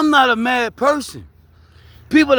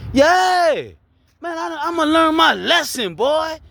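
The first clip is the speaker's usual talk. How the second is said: higher, fired up; 7 semitones higher, slower than usual. Real, not acted.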